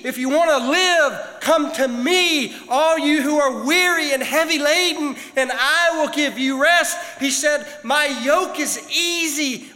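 loud, high, stretched-out preaching; preaching at full force. Acted. Preacher building to the climax for the church, full force. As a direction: drive the message home to the congregation.

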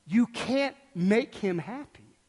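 normal talking voice

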